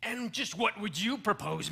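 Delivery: ordinary talk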